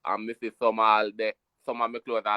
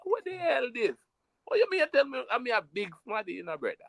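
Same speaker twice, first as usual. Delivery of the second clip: indignant retort; 10 semitones higher than usual. Retold or imitated. His indignant retort in the story.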